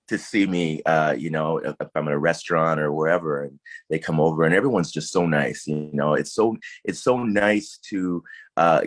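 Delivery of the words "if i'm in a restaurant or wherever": normal talking voice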